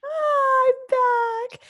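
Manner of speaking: high, drawn-out excited squeal. Real, not acted. Returning host greeting the podcast crew, excited. Announcing her return to the show.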